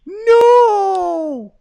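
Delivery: very high, loud crying no; real anguished shout. Real, not acted. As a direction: yell it out in real dismay. Reacting to fighter's crash.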